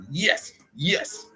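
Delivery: high-pitched re-enacted exulting; triumphant cheer. Watching the jerks' ball roll into traffic, triumphant. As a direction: savour each one like a small victory.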